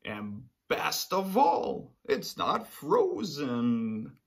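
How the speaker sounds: very slow, drawn out pleasure; slow delight. Acted. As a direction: savor each word. Delighted bear.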